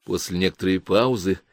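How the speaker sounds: ordinary delivery, nothing marked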